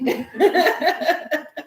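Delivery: bright high burst of laughter; laughing at her own request. Real, not acted.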